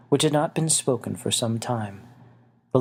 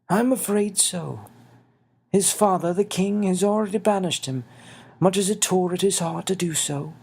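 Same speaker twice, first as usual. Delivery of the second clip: little winged man, sorrowful; 8 semitones higher than usual. Acted. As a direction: break bad news gently to a friend. Xavier's voice.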